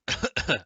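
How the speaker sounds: sudden cough on a drink; choking cough. Real, not acted. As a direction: cough as water goes down wrong. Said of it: "that went down the wrong way".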